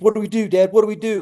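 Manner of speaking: put-on high, frightened child's question; scared kid. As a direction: plead like a scared boy. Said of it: "Austin's so scared".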